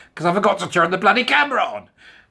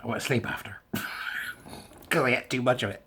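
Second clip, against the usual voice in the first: soft unvoiced confession; hushed giggling admission; whispered aside; 6 dB quieter than usual. Real, not acted. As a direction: whisper it like a guilty secret. Confiding whisper about overeating.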